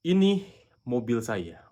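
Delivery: slow, loud dictation of the example; slow example sentence. Shown as a demonstration. Teacher reading an example sentence slowly to viewers. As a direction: read the sentence out slowly for the class. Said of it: "ada kalimat".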